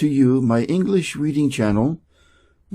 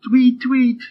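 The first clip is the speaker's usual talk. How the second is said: mock bird tweet; 9 semitones higher than usual. A narrator's reading. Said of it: "laughed the birds".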